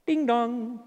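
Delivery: sing-song two-note doorbell chime; mimicked ding-dong chime; doorbell imitation. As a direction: voice a doorbell, drawn out and ringing. Imitating God ringing the doorbell.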